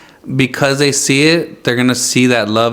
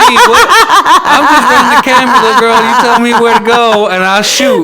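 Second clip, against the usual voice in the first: much higher and louder, laughing through it; laughing mock surrender; 10 semitones higher, 7 dB louder than usual. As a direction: giggle out a mock-meek reply to the little girl. Laughing mock reply to child.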